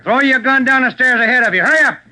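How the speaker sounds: loud, high command up the stairwell; shouted order. Acted. Detective orders the gunman to throw down his gun, shouting. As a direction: bark it up at the gunman.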